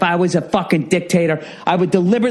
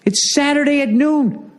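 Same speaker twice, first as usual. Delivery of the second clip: exasperated disbelief; 8 semitones higher than usual. Retold or imitated. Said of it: "I'm like".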